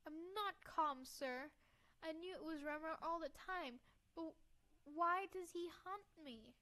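put-on upset girl. Girl protests to the old doctor, upset. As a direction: protest earnestly, slowing on the question.

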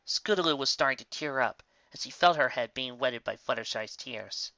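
usual speaking voice

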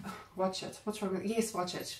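hushed, breathy aside to herself; soft voiceless mock inner voice; whispered self-urging. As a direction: whisper it like a guilty temptation. Quoting her own urge to watch.

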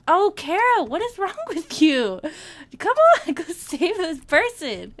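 high, loud exasperation with a laugh; loud, high-pitched pleading reproach; exasperated scolding. Real, not acted. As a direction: scold a useless lifeguard, half laughing. Scolding game lifeguard.

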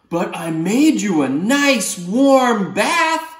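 high, slow, sweet octopus. Acted. Octopus offering the boy a bath, slow and high. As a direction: offer it gently and slowly. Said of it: "And he says".

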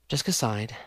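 ordinary delivery, nothing marked

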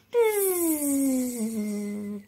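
long droning bee buzz. Shown as a demonstration. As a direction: buzz like a bumblebee.

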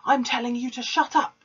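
high, loud command; roared. A narrator's reading. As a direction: flatten her with a roar. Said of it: "Trunchbull roared".

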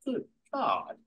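high-pitched posh English exclamation; English con man. Retold or imitated. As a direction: gasp it out like a startled English gent. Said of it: "But it's like".

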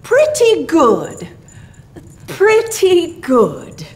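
leisurely, falling, considered verdict; Santa Claus. A narrator's reading. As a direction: stretch each phrase as if weighing it up. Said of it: "Santa Claus answered".

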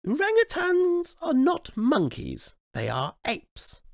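high, slow prim correction; slow high queenly put-down; correcting. Acted. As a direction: correct him like a strict governess. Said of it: "corrected the queen".

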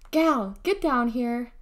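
slow, loud summons; summoning. A narrator's reading. Mother summons her daughter downstairs, calling firmly. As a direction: holler it as an order. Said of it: "Clarice summoned her".

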